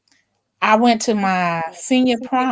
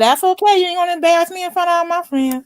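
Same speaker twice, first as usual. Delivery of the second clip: high-pitched, quick retold defiance; defiant retort; 7 semitones higher, faster than usual. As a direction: act out the cousin brushing off a threat. Cousin's defiant reply to mother, retold.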